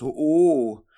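sudden loud oh-oh blurt; loud tic outburst. Acted. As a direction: blurt it out involuntarily. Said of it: "kam noch ein lautes".

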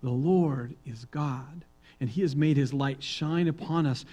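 ordinary delivery

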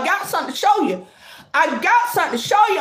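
rushed, eager repeating; blurting it out fast; hurried excited teaser; fast teasing. Real, not acted.

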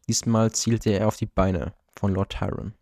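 usual speaking voice

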